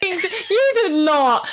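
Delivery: slow, high, shocked gasp; can't believe it, laughing; surprised disbelief. Real, not acted. Stunned at a friend's story.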